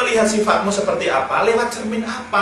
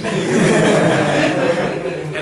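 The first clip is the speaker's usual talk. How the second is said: loud laugh; 5 dB louder than usual. Real, not acted. Laughing at his own story.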